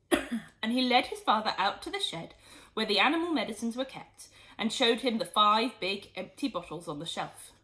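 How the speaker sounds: plain reading voice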